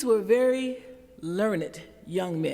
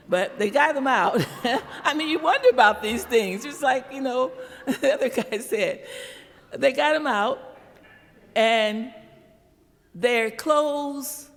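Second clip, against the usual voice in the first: laughing; 4 semitones higher, 5 dB louder than usual. Real, not acted.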